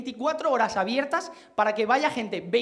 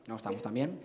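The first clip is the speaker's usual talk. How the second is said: much lower, hushed repeat; low aside; 12 semitones lower, 12 dB quieter than usual. Real, not acted. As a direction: drop the voice to a quiet admission.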